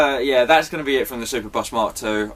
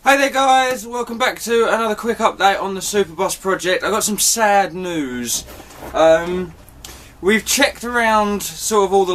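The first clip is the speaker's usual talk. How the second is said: video intro; 7 semitones higher than usual. Acted. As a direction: greet viewers in a raised presenter voice. Recorded video intro take.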